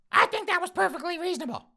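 put-on high, loud huffy old lady; old woman voice. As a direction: squawk it out like an outraged old lady.

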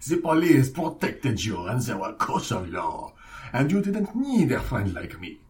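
hammed-up French mob boss; French accent. Redoing the godfather's opening speech for French audiences, mock French accent. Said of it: "How would we do this in a French".

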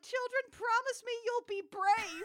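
high, solemn mock farewell; a grave, hammed-up goodbye to the kids; solemn mother voice. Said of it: "And Crystal's like".

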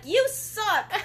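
high, loud mocking taunt; mocking friends. Mimicking friends taunting her over sherbet, high and loud. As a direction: jeer it like a bratty friend. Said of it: "And they were like".

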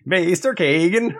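high, hammed-up Mario-like accent; Maester Kagan. Shown as a demonstration. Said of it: "He sounds like a Mario".

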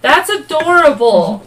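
high, loud, slow delight; thrilled and charmed; gushing. Real, not acted. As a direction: gush like it's the cutest thing. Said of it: "I got too excited".

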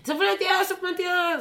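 very high, loud squealing mimicry of the man; shrill-high hysterical protest, mocked. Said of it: "han blev så här hysterisk typ".

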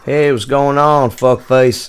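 slow, put-on southern drawl greeting a friend; lightly done southern accent, taking it slow. Host greets his co-host on the phone in a mock southern accent. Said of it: "working on my southern accent".

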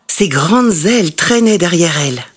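ordinary delivery

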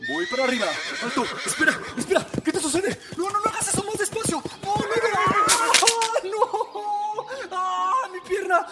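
high, panicked cries of pain; panicked fall. Acted. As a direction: scream as the horse throws you. Daughter thrown from the horse.

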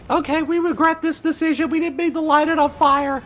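put-on squeaky child voice; high kid voice. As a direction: sound like a guilty kid. High voiced kids' apology.

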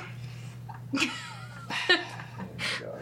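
soft high airless laugh; breathy laugh. Real, not acted. Laughs at own translation.

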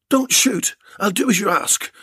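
high, panicked blurt; terrified. Acted. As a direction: beg in terror. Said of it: "blurted a terrified Bill".

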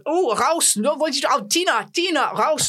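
imitation of hecklers shouting over each other; imitated angry crowd. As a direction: call out like a booing German audience. Imitates booing German crowd calling out.

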